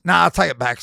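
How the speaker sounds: loud, high jokey command; mate telling him to take the guitar back; loud mock order. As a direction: bark it out like a mate having fun.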